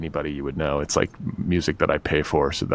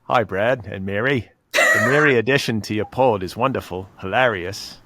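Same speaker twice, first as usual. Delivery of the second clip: reading in an Australian accent; 3 semitones higher than usual. Shown as a demonstration. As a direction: read the letter like an Aussie. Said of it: "should I do this in an Australian accent".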